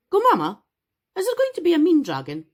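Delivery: put-on high, quick little-boy voice; high excited kid asking mom; eager child voice. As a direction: pitch up as the curious child, rushing the question. Child Noah voiced in story.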